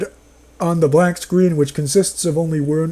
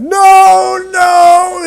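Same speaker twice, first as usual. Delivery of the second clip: high, loud drawn-out "no" in mock horror; mock horrified scream; 14 semitones higher, 7 dB louder than usual. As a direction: cry out in over-the-top horror.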